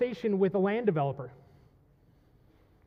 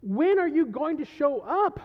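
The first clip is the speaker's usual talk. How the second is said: high, insistent cry to God; exasperated plea in a raised voice; 5 semitones higher, 4 dB louder than usual. Acted. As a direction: press God urgently for an answer. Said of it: "David starts crying out".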